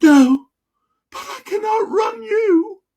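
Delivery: high squeaky shout; squeaky little dinosaur voice; little dinosaur shouting back. Acted. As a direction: yell it back over your shoulder, tiny and cheeky. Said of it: "The little fella shouts back".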